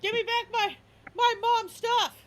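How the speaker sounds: very high, loud outraged shout; dog yelling after truck. Acted. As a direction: yell after the thief like a frantic dog. Dog yells after garbage truck.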